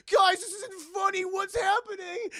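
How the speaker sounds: hammed-up frightened whine; panicked wail. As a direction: wail to be let out. Trapped Justin panicking, acted.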